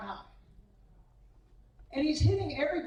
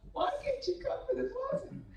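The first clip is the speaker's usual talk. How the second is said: much higher, fast, exasperated question; 12 semitones higher, 5 dB quieter, faster than usual. Retold or imitated. Coach asking Buffy why she missed again, high and fast. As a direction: throw up your hands in disbelief.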